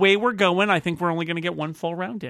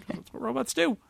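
ordinary talk, nothing marked

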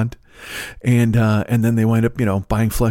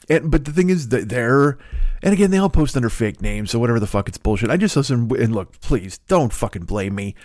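usual speaking voice

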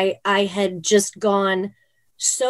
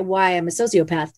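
ordinary delivery

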